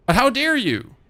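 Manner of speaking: high, loud mock offence; mock indignation. Repeats mock outrage at chat.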